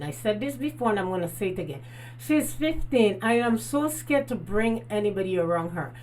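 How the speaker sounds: ordinary delivery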